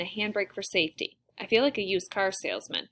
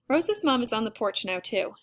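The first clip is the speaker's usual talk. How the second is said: ordinary delivery, nothing marked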